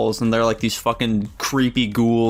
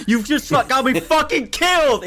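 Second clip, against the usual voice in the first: retold scream; 14 semitones higher than usual. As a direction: imitate a desperate scream, half laughing. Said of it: "just is fucking screaming".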